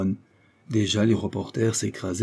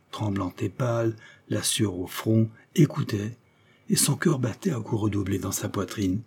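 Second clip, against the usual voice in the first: plain reading voice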